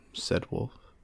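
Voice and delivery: ordinary delivery, nothing marked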